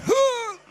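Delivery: high sharp "Huh!" grunt; motivating grunt. Retold or imitated. Comedian psyching himself up, sharp high grunt. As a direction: bark a pumped-up grunt. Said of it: "Sounds like a seagull hitting a window".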